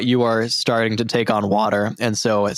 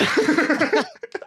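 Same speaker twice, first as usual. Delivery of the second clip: villain laugh. Acted.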